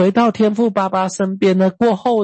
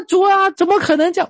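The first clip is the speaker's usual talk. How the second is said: high, fast whining protest; whiny complaint to God; 11 semitones higher than usual. Retold or imitated. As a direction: play the fed-up child grumbling at God. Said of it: "我就是这样跟神讲啊".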